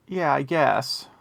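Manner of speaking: ordinary talk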